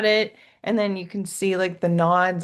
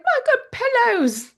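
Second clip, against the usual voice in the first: high, wailing dismay; mock dismayed housekeeper; 5 dB louder than usual. Housekeeper finding her shot pillows, mock wailing. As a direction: lament the ruined pillows.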